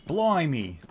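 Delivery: loud, jokey Australian exclamation; mock Australian. Streamer goofing for chat in a mock Australian accent. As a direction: blurt it like a cartoon Australian. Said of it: "saying random Australian stuff".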